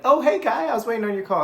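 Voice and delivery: high, loud, eager greeting; delighted high-pitched hello; excited prospect. Shown as a demonstration. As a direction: squeal it like they've waited all day. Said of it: "but if they're like".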